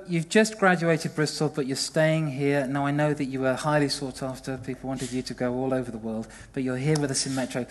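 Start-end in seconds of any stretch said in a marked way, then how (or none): none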